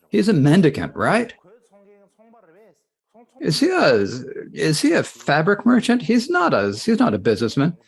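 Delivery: a king scolding his servant ladies, a touch angry; indignant scolding. Acted. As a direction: scold them, pitch climbing in disbelief. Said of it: "He got angry".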